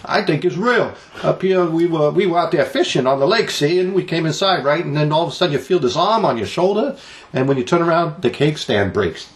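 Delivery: high, far-off New England yokel voice; mock New England accent. Host mocking a New Hampshire local, high New England accent. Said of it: "People don't talk like that in New Hampshire".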